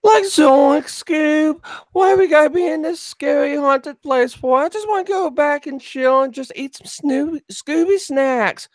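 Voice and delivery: very high, cracking cartoon slacker voice; squeaky cartoon sidekick, pleading to go home; Shaggy impression. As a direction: whine it high like a scared cartoon stoner. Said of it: "only one accent I can do".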